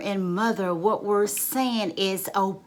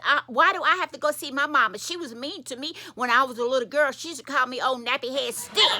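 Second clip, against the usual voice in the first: mildly indignant, quick and higher; 6 semitones higher, faster than usual. Acted. Daughter objects to visiting her mama, indignant. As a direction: protest it in a rush.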